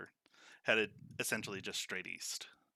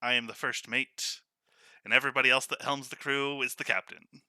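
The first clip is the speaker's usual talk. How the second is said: louder, lightly voiced Ravin; a hint of a smug first mate; 3 semitones higher, 8 dB louder than usual. First mate Ravin answers crow.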